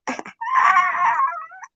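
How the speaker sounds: high squealing laugh; shrieking laugh. Real, not acted. Laughing at his own joke, a high shriek. Cracking himself up.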